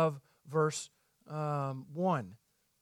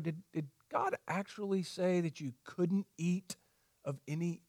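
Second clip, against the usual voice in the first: Satan's sly question; 2 semitones lower, 7 dB quieter than usual. Retold or imitated. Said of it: "he's going".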